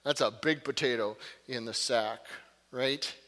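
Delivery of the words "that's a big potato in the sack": usual speaking voice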